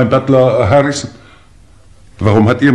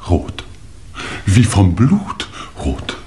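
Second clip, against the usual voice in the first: normal talking voice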